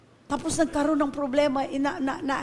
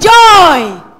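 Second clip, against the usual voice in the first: loud call of the word Joy; shout joy; 4 semitones higher, 17 dB louder than usual. Real, not acted. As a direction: cry it out for the crowd to echo. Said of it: "Say, "Joy!". (malakas na sigaw ng "Joy!")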